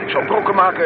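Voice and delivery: high, loud protest over the engine; high, loud driver's protest; strained protest. Acted. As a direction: warn him you'll crash. Driver protesting during fast drive.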